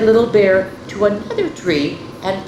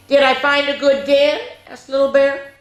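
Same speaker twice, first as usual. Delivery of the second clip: little bear cub; 9 semitones higher than usual. Acted. Little Bear asks Daddy on the way home, high and eager. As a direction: ask proudly like a small cub.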